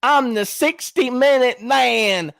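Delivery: slow, loud, hammed-up brag; mocking show-off voice, drawn out; imitating Kyle Pizzle. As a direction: stretch every word into a mock boast. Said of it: "He says it like this".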